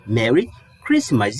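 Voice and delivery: "Merry Christmas" said slowly and clearly; model pronunciation. Shown as a demonstration. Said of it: "Pronúncia?".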